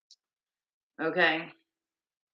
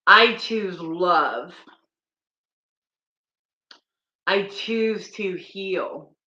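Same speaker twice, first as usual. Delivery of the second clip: slow, earnest affirmation voice; healing affirmations. Acted. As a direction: speak each line as a vow to yourself. Reciting healing affirmations slowly.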